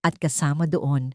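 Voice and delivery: ordinary delivery, nothing marked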